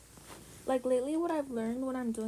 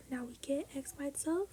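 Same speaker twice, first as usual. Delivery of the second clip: ordinary talk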